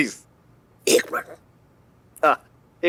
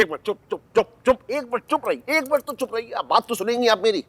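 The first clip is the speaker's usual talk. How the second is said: high-pitched pleading for a hearing; exasperated interruption; 6 semitones higher than usual. Acted. Man begging the accusing woman to let him speak, exasperated. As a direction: cut in repeatedly, rising in pitch.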